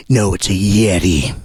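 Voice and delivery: low, dull-witted voice flatly insisting; stubborn believer. Acted. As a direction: play the dim true believer. Arguing believer voice in mock debate.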